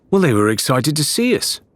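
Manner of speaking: defensive. A narrator's reading. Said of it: "Mercia said defensively".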